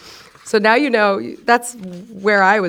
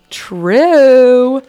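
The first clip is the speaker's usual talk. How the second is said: loud, higher mock-solemn "True"; emphatic agreement; 4 semitones higher, 8 dB louder than usual. Real, not acted. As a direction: declare it like a verdict. Agreeing loudly with own journal.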